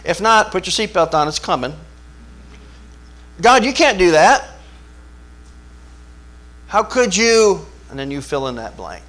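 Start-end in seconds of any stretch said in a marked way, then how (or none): 3.4-7.7 anguished protest. Retold or imitated. Believer protests to God.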